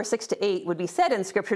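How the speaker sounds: usual speaking voice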